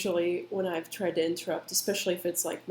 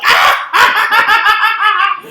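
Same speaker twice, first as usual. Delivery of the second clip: mock startled shriek; 17 dB louder than usual. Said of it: "we were like".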